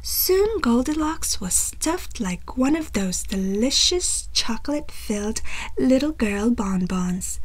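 ordinary delivery

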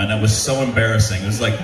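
usual speaking voice

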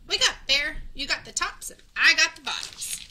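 a cheerful shout across the field; calling out. A narrator's reading. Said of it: "Hare called out".

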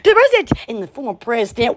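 ordinary talk, nothing marked

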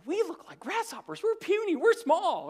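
squeaky grasshopper voice; mock timid spies. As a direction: make them sound tiny and scared. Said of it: "They said".

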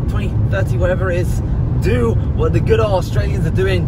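loud address to viewers over music; talking over music. Real, not acted.

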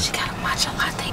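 hushed whisper to the camera. Real, not acted. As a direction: whisper it so she can't hear. Spying on car ahead.

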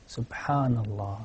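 normal talking voice

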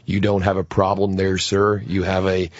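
put-on low, over-enunciated American accent; mock a Brit's terrible American accent, pitched right down; Hugh Laurie. Comic imitating TV doctor, low exaggerated American accent. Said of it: "sounds like a terrible American accent".

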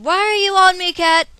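loud, high-pitched scolding; exasperated shout. Real, not acted. Player scolding the cat that climbed on them, exasperated shout. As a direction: yell at the pet in protest.

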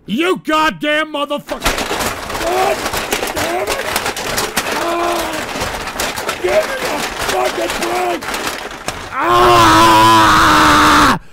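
furious shouting. Real, not acted. Streamer raging at another gross donation, furious shouting. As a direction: lose it completely at the chat.

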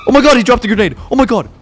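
high, loud alarm; panicked yell. Acted. Player sees a grenade dropped, panicked yell. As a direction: cry out in sudden panic.

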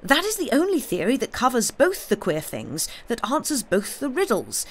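high, insistent reasoning; carried away by a glimpse of the truth; feverish excitement. A narrator's reading. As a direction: rattle out the theory eagerly. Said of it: "he cried feverishly".

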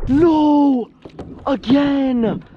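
very high, very loud cry of despair; real anguished yell. Real, not acted. Fish lost again, anguished high loud yell. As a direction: scream it as the fish escapes again.

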